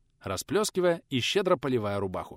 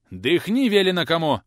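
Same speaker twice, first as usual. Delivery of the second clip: loud gruff bark; 6 semitones higher, 8 dB louder than usual. A narrator's reading. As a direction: bark the order at him. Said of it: "рявкнул преподаватель". (громкий рык)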